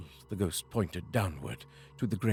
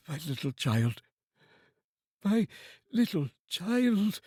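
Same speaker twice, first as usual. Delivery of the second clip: breaking down; 8 semitones higher than usual. Acted. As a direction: let the father break down over his child. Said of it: "He broke down all at once".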